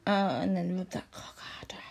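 breathy intimate whisper; hushed whispered instruction. Acted. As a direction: whisper it close in his ear.

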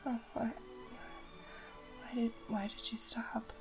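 ordinary talk, nothing marked

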